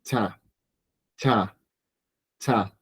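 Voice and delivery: cha with a sharp CH, repeated softly; CH syllable. Shown as a demonstration. Teacher showing the CH sound at word start. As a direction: clip out cha, cha, cha, fading each time. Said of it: "es una C H".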